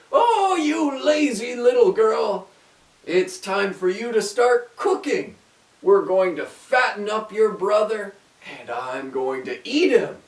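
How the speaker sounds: high, loud witch scolding; wicked witch. A narrator's reading. Witch orders Gretel to cook, high and loud. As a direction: sneer at her like a cruel witch. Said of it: "she said".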